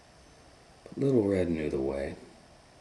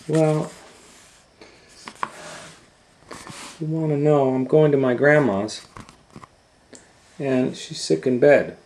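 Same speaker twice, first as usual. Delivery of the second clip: put-on much higher, loud eager girl; chatty little girl; 9 semitones higher, 11 dB louder than usual. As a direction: chatter on like a trusting child. Girl answers wolf.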